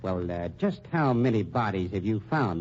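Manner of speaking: usual speaking voice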